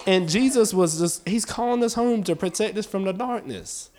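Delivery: loud, driving preacher's cadence; preaching. Acted. Preacher urging the church with a loud driving voice. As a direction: build the sermon hard and loud.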